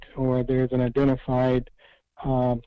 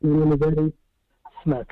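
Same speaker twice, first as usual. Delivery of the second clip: muffled, as if through a wall; muffled voice, hard to hear; 3 semitones higher, faster than usual. Real, not acted. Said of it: "You sound muffled".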